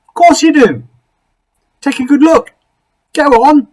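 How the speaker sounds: high, loud cheery creature voice. Acted. Creature greets Something Else at his door, high and cheery. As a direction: bounce in like an overfriendly little creature. Creature urges him to look.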